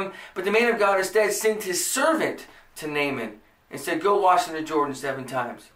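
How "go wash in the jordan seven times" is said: normal talking voice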